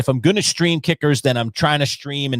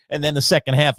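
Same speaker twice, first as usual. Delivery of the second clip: usual speaking voice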